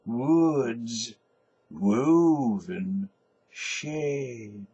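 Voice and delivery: slow, thick, drawn-out vowels; drunken sleeper murmuring verse. Acted. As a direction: drag each vowel, half asleep. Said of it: "murmurs thickly with prolonged vowels".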